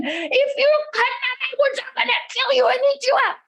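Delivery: high, screaming troll threat; roaring troll. A narrator's reading. Huge troll threatens the woodcutting boy, screaming and roaring. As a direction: scream it as a raging troll, quickening at the end. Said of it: "screaming and roaring at him".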